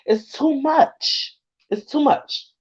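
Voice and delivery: high, slow, choked-up repeat; fighting back tears; overwhelmed and close to crying; near tears. Real, not acted. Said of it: "I don't wanna cry".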